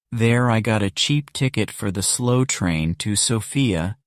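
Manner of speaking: plain reading voice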